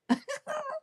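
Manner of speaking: soft, very high giggle; teasing giggle. Real, not acted. Psychic after teasing a scared viewer, soft high giggle.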